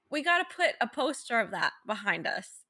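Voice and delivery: normal talking voice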